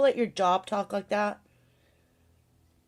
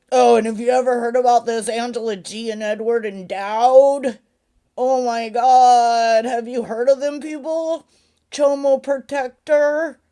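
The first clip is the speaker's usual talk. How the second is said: hammed-up loud gossip to a stranger; mock outrage; 8 dB louder than usual. Imagined talk with a stranger at Walmart, mock outrage.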